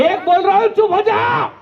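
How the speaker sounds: high-pitched angry warning to the wife; hammed-up military shout; hot-tempered colonel. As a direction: warn like an irate officer. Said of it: "colonel साहब इतने गुस्से वाले रहते हैं".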